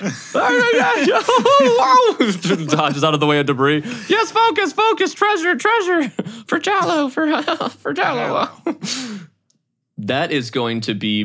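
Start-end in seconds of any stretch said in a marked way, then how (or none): 0.0-2.7 very high, loud, half-laughing panic; startled high-voiced driver. Drona dodging debris while driving, high startled yelps. 4.1-8.5 very high, loud self-urging; high-voiced driver psyching up. Drona urging focus on the treasure, high frantic voice.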